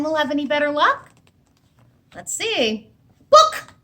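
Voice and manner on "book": high, loud chicken cluck of book; polite cluck. Acted. Hen asks the librarian for a book with a high, loud cluck. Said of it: "clucked the hen politely".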